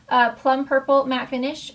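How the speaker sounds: ordinary talk, nothing marked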